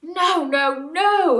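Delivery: loud desperate howl of protest. A narrator's reading. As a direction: howl it out in despair. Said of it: "howled the beasts".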